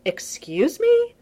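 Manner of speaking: high, loud, slow disbelief; incredulous. Acted. Tony shocked at what Steve just said, incredulous.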